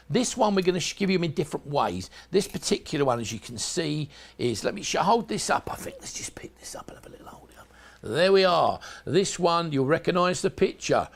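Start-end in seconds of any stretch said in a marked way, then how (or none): 5.7-7.7 quick whispered aside to himself. Real, not acted. Presenter muttering to himself while lifting the glove, whispered. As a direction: whisper it fast under your breath.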